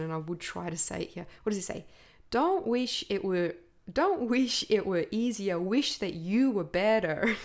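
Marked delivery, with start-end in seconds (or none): 2.3-7.4 put-on Jim Rohn's accent. Podcast host quotes Jim Rohn to listeners, lightly imitated accent. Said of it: "he says it in such a beautiful accent".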